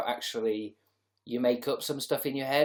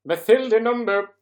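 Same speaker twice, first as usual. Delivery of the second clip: much higher, much louder sing-song call of Mathilda Nombo; exaggerated name call; 11 semitones higher, 12 dB louder than usual. Shown as a demonstration. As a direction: belt Mathilda Nombo out like a fanfare. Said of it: "am I saying your name right".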